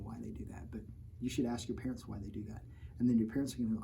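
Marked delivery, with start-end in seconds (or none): 0.0-2.6 soft unvoiced whisper; whispered aside. Real, not acted. Teacher whispering a secret to the class.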